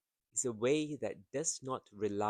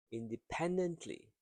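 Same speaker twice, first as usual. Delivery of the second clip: "independently" with "pen" raised in pitch; stress on third syllable; 7 semitones higher than usual. Shown as a demonstration. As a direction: make "pen" the peak of "independently". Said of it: "stress syllable is the third one".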